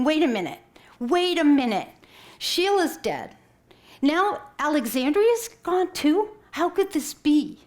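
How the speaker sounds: shocked disbelief. Retold or imitated. Retelling her own shocked thoughts.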